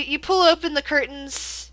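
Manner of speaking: ordinary talk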